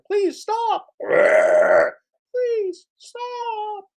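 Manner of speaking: high falsetto whine; squeaky pleading. Pretend. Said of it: "Pedro and John Billings like".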